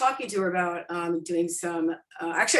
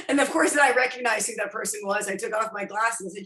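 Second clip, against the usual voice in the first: usual speaking voice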